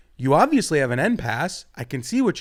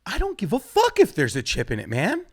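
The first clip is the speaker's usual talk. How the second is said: ordinary talk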